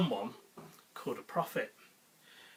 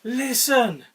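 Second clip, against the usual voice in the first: loud call; 8 semitones higher, 9 dB louder, much slower than usual. Acted. God calls again.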